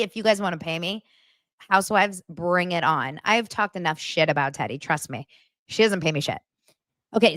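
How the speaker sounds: ordinary delivery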